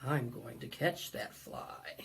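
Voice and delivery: low, soft elephant voice to himself; plotting elephant, low and hushed; scheming elephant. Acted. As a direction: rumble it quietly like a big sneaky elephant. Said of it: "says to himself".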